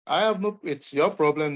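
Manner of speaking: normal talking voice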